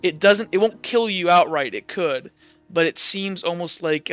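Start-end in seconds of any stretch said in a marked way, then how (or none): none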